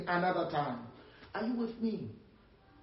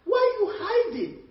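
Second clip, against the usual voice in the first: high-pitched, raised question as the Almighty; God questioning; 12 semitones higher, 4 dB louder than usual. Acted. Preacher as God questioning hidden Adam, high-pitched. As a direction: put the question high and incredulous to someone hidden. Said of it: "And God says".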